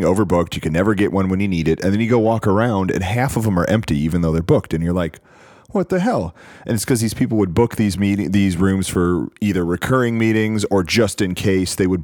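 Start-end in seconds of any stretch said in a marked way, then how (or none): 5.7-6.3 surprised. Retold or imitated. Baffled at empty booked rooms.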